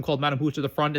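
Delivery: ordinary delivery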